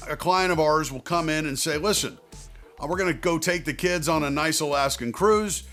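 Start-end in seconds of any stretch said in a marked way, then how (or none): none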